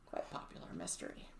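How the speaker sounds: soft whispered aside. Real, not acted. Ironic aside.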